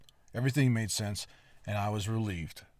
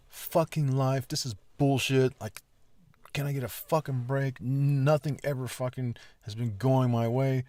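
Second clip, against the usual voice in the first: frustrated rant; 4 semitones higher, 5 dB louder than usual. Retold or imitated. Retold inner rant at warning light.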